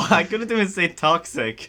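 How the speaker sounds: laughing. Real, not acted. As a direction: chuckle through it at yourself. Laughing after failed word.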